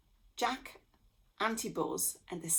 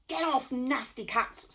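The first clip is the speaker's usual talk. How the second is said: snarled; 6 semitones higher, 4 dB louder than usual. A narrator's reading. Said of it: "snarled the Greiser".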